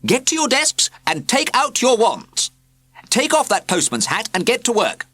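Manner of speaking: high, loud shout; shouting teacher. A narrator's reading. Wizard teacher bursts in shouting at pupils. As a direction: call the class to order. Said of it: "he shouted".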